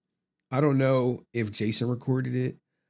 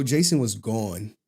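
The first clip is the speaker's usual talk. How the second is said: usual speaking voice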